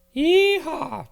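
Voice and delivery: a high, loud cowboy whoop; country holler. Acted. Brent hollers with joy about barbecue, loud cowboy whoop. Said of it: "Brent hollered".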